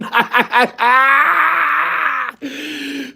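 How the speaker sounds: shrieking, crying laughter; real laugh that breaks into a cry; howling laugh. Real, not acted. Cracking up at his own comeback on the phone, howling.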